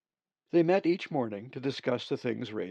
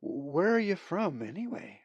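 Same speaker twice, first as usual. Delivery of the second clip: astonished; 7 semitones higher than usual. A narrator's reading. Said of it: "Molly was astonished".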